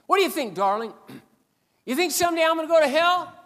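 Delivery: loud, high mocking challenge; taunting mechanic. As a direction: dare him, jeering.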